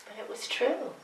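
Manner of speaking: softly, nearly to herself; soft insistence. Real, not acted. [name] quietly insisting to the audience, soft. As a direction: let it fall quietly, still sure.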